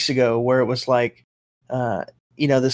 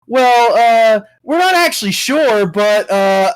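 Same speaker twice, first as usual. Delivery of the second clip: mock ad hedging; 6 semitones higher, 5 dB louder, slower than usual. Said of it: "And it's like".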